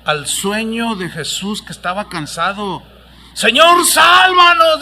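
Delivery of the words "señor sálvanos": grito desesperado, agudo y fuerte; grito de auxilio. Acted. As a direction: pide socorro gritando en la tormenta. (discípulos gritan a Jesús en la tormenta)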